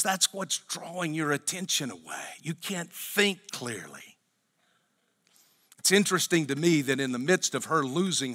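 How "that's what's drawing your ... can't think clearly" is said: quiet quote. Retold or imitated.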